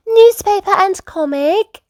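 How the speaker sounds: very high little pig; Peppa. A narrator's reading. Peppa delivering the order, very high piglet. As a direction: announce it in a high child voice. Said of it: "Peppa said".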